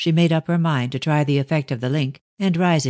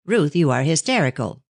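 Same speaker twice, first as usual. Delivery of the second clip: plain reading voice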